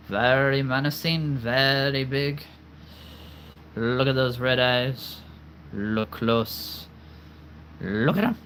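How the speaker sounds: menacing villain, drawn out; a lightly voiced villain. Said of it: "That's my villain voice".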